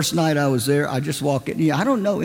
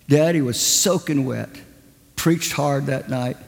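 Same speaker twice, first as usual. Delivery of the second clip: usual speaking voice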